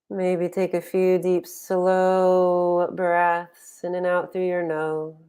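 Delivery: unhurried guiding voice with long pauses; guided meditation. Real, not acted. Meditation teacher settling online group into posture and breath, guided meditation. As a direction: ease them into stillness, phrase by phrase.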